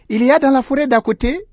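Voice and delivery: high, quick little sheep; sheep's voice. A narrator's reading. Sheep answering the lion's question, high and innocent. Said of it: "dit la brebis".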